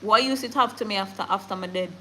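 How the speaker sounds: normal talking voice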